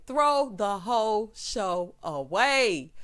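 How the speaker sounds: word by word, drawn out; very slow, each word separately weighted; slow emphatic repeat. Real, not acted. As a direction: spell out the dismissal slowly.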